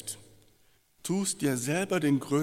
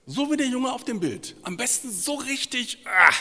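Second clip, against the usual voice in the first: mock bravado; 11 semitones higher, 7 dB louder than usual. Preacher mimics the strong boy.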